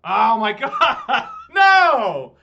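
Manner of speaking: shrieking in disbelief, laughing; raging gamer. Real, not acted. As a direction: scream it, outraged and laughing. Opponent finds his two bolts.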